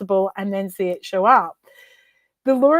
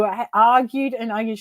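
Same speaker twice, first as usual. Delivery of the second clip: ordinary delivery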